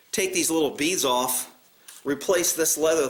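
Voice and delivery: ordinary delivery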